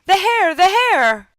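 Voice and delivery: high, loud cry of alarm; storybook man yelling for help; calling out for help. A narrator's reading. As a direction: cry out for rescue, high and urgent. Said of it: "hears him call out everywhere".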